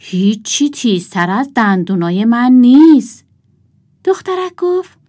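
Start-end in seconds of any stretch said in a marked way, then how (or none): 0.0-3.2 louder, mildly grumbling wolf; touch of surly boasting. A narrator's reading. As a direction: grumble back, refusing to be outdone. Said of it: "گرگ غرولند کنان گفت".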